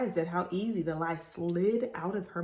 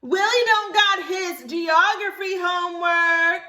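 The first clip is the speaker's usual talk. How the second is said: much higher, loud, slow tattling shout; announcing; 11 semitones higher, 9 dB louder than usual. Acted. Libby tattling to the teacher, loud and high. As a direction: blurt it to the whole class. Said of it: "Libby announced".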